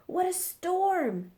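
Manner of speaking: high, chirpy little bird voice; twittering wren. Acted. Wren chirping to the crowd about the storm, high and twittery. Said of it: "twitters wren".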